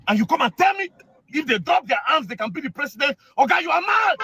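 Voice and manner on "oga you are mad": high angry retort; angry insult. Real, not acted. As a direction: snap it at the general.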